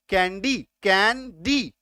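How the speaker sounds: "Candy" slowed into कैं, डी; teacher's slow two-beat "candy"; syllable by syllable. Shown as a demonstration. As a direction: sound out both beats of "candy". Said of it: "दो syllable आ गया".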